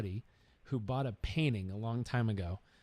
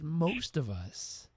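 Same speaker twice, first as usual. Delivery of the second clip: slow earnest. Retold or imitated. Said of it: "And he said".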